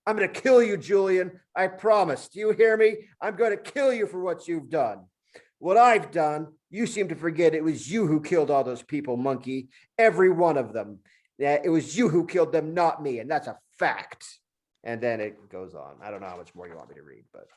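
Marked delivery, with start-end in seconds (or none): 0.1-5.1 high, loud gloating monkey threats; threatening monkey. Acted. Monkey threatens Julian from the floor, loud and high. 5.6-14.2 high, raised accusing voice; angry retort. Acted. Julian accuses the monkey of the killings, raised and angry.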